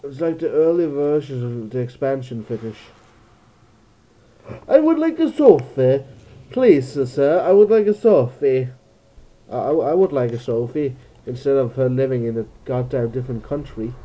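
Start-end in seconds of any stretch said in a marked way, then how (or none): 4.7-8.7 high, loud mock-British request; put-on British, pitched way up; hammed-up British accent. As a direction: plead in a silly British voice. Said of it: "I can't do British accents anymore".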